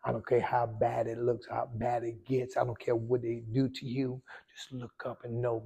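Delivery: ordinary talk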